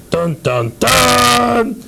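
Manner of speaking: voiced "dun, dun, dun" fanfare, last note leaping high; mock dramatic sting. Host hyping his trivia game with a mock dramatic sting. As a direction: sing out a suspense sting, holding the last note.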